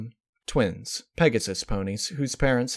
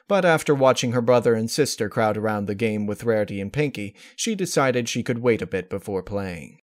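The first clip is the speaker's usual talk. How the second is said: ordinary delivery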